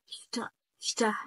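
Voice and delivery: شت، شته whispered slowly as a voiceless sht; German st demo. Shown as a demonstration. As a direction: breathe out the sht sound. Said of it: "الاس تي تعمل لك شت".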